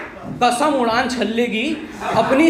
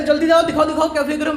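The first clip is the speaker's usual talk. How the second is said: suspicious father; 6 semitones higher, faster than usual. Retold or imitated. Father asking son what he threw, higher demanding. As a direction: press him to show it.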